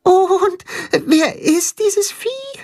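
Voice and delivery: eager little dog; very high and slow; high puppy voice. A narrator's reading. Tacho's high voice.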